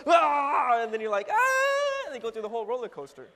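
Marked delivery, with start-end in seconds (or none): 0.1-0.8 loud, high mock roller coaster scream; sudden acted shriek of fright; startled scream. Acts out the sudden drop. 1.3-2.1 very high, loud sustained shriek; hammed-up scream all the way down; roller coaster scream. Screams through the whole coaster.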